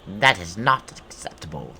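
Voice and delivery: loud put-on monster objection; indignant goblin boss. Streamer voicing the boss refusing an intruder, indignant goblin voice. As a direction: object loudly as a hammed-up goblin.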